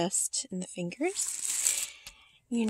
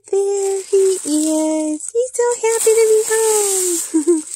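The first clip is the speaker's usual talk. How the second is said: much higher and louder cooing, slow; 11 semitones higher, 12 dB louder, slower than usual. Real, not acted. Cooing to the new doll in high baby talk. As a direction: coo it like to a newborn. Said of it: "I cannot help talking like that".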